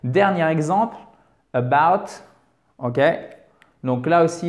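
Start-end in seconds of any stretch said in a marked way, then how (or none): 1.5-2.1 "about" with a strong second syllable, BOUT; word stress. Shown as a demonstration. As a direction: barely sound the "a", punch BOUT in "about". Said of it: "il faut bien faire l'accent tonique". (« about » accentué sur BOUT, première syllabe effacée)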